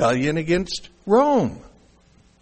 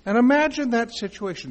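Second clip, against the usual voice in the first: ordinary delivery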